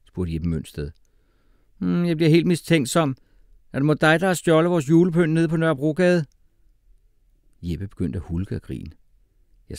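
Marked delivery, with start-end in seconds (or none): 1.8-6.2 high, loud, accusing teacher voice; mock-suspicious teacher. A narrator's reading. Teacher jokingly accusing pupil of theft, high and loud.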